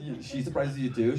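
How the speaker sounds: ordinary delivery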